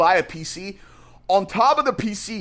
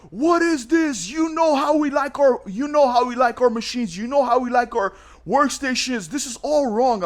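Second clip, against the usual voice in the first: imitating angry customer; 5 semitones higher than usual. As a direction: act out an indignant customer's rant. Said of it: "He emails me cussing me out".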